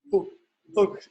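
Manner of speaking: said through a yawn; yawning while talking. Real, not acted. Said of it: "excuse me for yawning".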